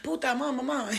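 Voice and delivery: high, rapid-fire Dominican Spanglish; Dominican cabbie impression. Cab driver hailing her, excitable Dominican accent. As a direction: chatter in a hammed-up Dominican accent. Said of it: "you know the Dominicans".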